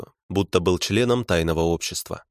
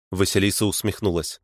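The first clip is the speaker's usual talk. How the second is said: ordinary delivery, nothing marked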